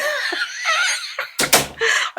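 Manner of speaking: high, loud burst of flustered laughter; embarrassed laugh. Real, not acted. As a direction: burst out laughing, caught off guard. Flustered after sending intruder out of room.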